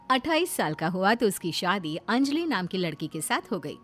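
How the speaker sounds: ordinary delivery, nothing marked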